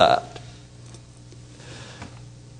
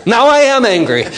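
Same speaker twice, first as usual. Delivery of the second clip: lightly higher mock woman; woman's voice; 9 semitones higher, slower than usual. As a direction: play her snapping back. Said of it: "she goes".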